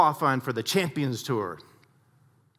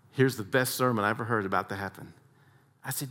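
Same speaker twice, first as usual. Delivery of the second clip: ordinary talk, nothing marked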